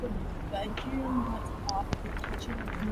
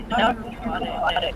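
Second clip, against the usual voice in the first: glitchy robotic audio; choppy digital connection distortion; 7 dB louder, slower than usual. Real, not acted. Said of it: "Yeah, I think that's my side".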